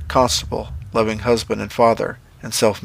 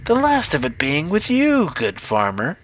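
slow witch voice, leaping high; chuckling witch; 5 semitones higher than usual. A narrator's reading. Witch telling the farmer her business is with him, amused witch voice. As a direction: toy with him in a swooping witch voice. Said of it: "The witch chuckled".

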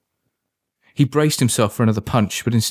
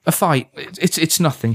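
higher, hurried stammer; said quickly; 6 semitones higher than usual. A narrator's reading. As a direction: brush it off hastily, stumbling. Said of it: "he said quickly".

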